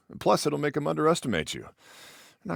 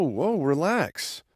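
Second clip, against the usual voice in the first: ordinary delivery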